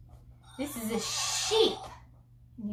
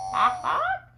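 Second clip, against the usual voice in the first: high-pitched chicken call; chicken squawk; 14 semitones higher, 4 dB louder than usual. Shown as a demonstration. Showing a toddler the chicken, high squawking. Imitates a chicken after signing it.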